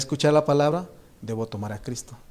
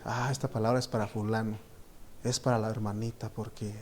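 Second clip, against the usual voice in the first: quiet aside; 3 semitones lower, 7 dB quieter than usual. Retold or imitated. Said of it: "uno como predicador dice".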